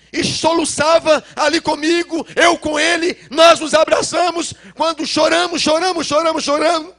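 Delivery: loud, high voice close to tears; tearful loud preaching. Real, not acted. Preacher loudly recalling crying in his father's arms. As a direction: cry it out as you preach.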